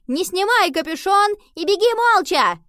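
high, loud urgent command; shouted order. A narrator's reading. Henry shouts orders to Nova as they run. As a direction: yell the instruction at full voice.

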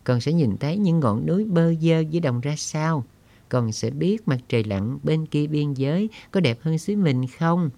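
put-on high child voice, wistful and dreaming aloud; dreamy little girl. Girl speaks to her uncle.

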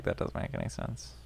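unvoiced whisper. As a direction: whisper it flatly. Whispered quote of troopers.

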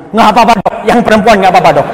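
eager husband. Acted. Husband begging for the daughter.

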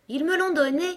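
ordinary delivery

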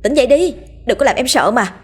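high, anxious pleading voice; worried young wife. Acted. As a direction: shake him awake in a worried rush. Wife wakes him from nightmare.

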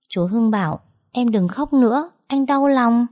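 put-on high small-child voice, parroting an adult; little boy. A narrator's reading. Son repeating his uncle's words to mother, high child voice. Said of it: "cái giọng ngọng líu ngọng lo".

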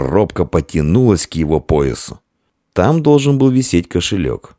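ordinary delivery

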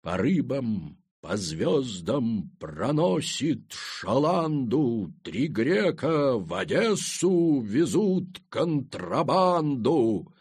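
very slow, grand recitation with stretched vowels; old-school theatrical declamation. A narrator's reading. Naked Semyon recites verse crawling over rocks, old-school stage declamation. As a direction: declaim it unhurriedly like an old Moscow Art Theatre actor, swelling toward the end. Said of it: "декламируя неторопливо в духе старой мхатовской школы".

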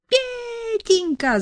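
very high, drawn-out pleading lament; keen over her son; lamenting wail. A narrator's reading. The mother lamenting over her son, very high and slow. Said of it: "запричитала маманя".

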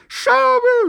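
very high, loud squeal; piercing falsetto yelp; thin-voiced howl. A narrator's reading. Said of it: "взвыл тонкий голос".

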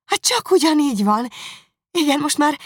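high, loud joyful cry; exclaiming. Acted. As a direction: burst out in amazement. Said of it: "kiáltott Jane".

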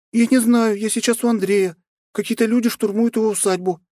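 put-on high, urgent young princess; young woman voice. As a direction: voice her as an alarmed young woman. (встревоженная княжна)

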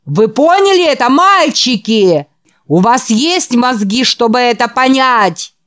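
loud, high revival preacher voice; fiery preaching. Acted. The preacher works up the boys in the hall with fiery preaching. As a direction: whip up the congregation.

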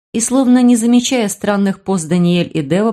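usual speaking voice

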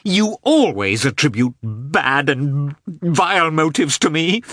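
resentful wife, slow and rising high; tearful anger. A narrator's reading. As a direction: accuse through angry tears, dragging the words and climbing. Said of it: "began with tears of anger".

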